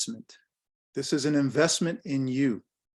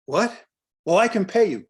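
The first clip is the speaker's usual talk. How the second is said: mock surprised protest, higher and louder; startled client; 6 semitones higher, 5 dB louder than usual. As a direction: play the baffled client offering money.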